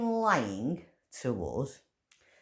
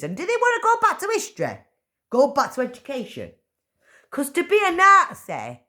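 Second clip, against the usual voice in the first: indignant; 7 semitones higher, 4 dB louder than usual. Real, not acted. Indignant rant about being called Nazi.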